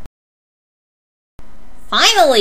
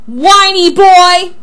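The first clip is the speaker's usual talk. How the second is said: very slow, loud, high jeer; escalated yelled taunt; 7 semitones higher, 9 dB louder, much slower than usual. Acted. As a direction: stretch it out high and loud to outdo your rival.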